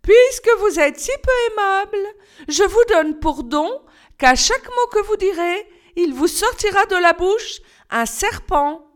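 high, piqued fairy voice; a miffed fairy casting a spell; high fairy voice, a touch of disappointment; disappointed fairy. Acted. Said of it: "dit d'un air déçu".

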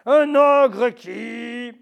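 very slow, loud, grave wolf reading; deep wolf voice. Acted. The wolf starts his scary story for the mouse, deep and slow. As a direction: drag it out in your darkest wolf voice. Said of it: "sa voix de loup, la plus grave".

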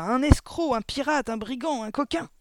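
loud, high volley of insults; furious punched-out list; yelled insults. A narrator's reading. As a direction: hurl each name like a blow. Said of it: "hurla mademoiselle Le Gourdin".

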